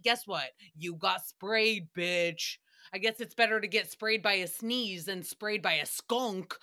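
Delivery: usual speaking voice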